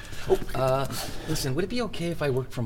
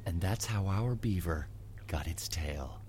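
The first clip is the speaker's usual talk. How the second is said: softer, slow storyteller's closing line; slow, lower and heartfelt, easing into the ending; more feeling; 4 semitones lower, 4 dB quieter, slower than usual. Shown as a demonstration. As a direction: wrap up the tale slowly and tenderly, pausing before the end. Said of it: "No, more hard".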